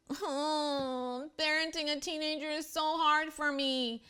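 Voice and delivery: high, whiny mock complaint; mock self-pity. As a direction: parody your own self-pitying moan.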